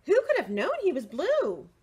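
high loud fast wonder; high amazed gossip. Acted. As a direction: marvel at the news quickly. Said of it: "everyone was talking".

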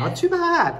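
a squeaky high not too bad, teasing but kind; high mock surprise. Real, not acted. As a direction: jump way up in pitch to soften the blow. Teacher consoles pupil's low score. (เสียงสูงแหลมแกล้งตกใจแต่ปลอบใจ)

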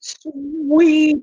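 high, loud, drawn-out squeal; gleeful cheer. Real, not acted. Host celebrates getting the okay to share, gleeful. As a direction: stretch it high and loud in mock delight. Exaggerated delight at being thanked.

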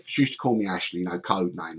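ordinary delivery

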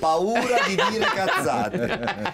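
laughing, slow, high Neapolitan accent imitation. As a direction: parrot a guest's Neapolitan accent, giggling. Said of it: "con quel bell'accento napoletano".

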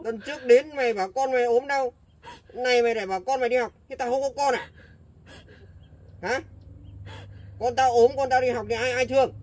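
loud, angry rant; angry scolding. Acted. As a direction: tear into her excuses. Landlord scolds excuses of tenant.

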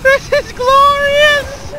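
very high, slow, drawn-out cry; triumphant cry. Real, not acted. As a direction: exult it slowly to the sky. Rocket jumping.

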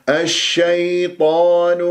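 slow chanted الشيطان with a smooth "ay"; soft glide ya. Shown as a demonstration. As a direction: stretch الشيطان, easing through the unstressed "ay". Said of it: "يا أولين ساكنة".

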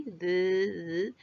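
buzzing, drawn-out th in "the"; voiced th. Shown as a demonstration. Teacher showing pupils where the tongue vibrates, voiced th. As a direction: hold the vibrating th of "the" with tongue at the teeth. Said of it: "Aquí sí vibra".

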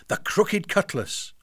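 usual speaking voice

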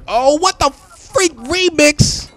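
high disbelieving cry; stunned outburst. Real, not acted. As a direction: stretch it out in shock. Shock at being killed.

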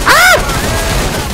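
high sudden yelp of fright; startled yelp. Real, not acted. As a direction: jump and yelp as if scared. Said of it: "Que susto da porra".

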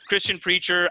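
ordinary delivery

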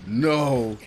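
normal talking voice

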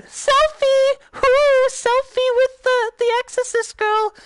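very high, loud falsetto whooping; high party-girl whoop; giddy selfie squeal. Pretend. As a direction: squeal with playful glee. Poses for a silly selfie.

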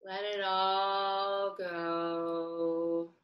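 very slow, drawn-out words easing down; slow breathing guidance. Real, not acted. Teacher guiding children's breathing, slow and drawn-out. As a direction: stretch the words to pace a long out-breath.

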